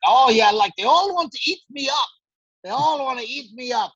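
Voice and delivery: a light mock shout at everyone; mock Kyle yell. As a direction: ham up the loudmouth housemate. Quoting Kyle screaming at everyone.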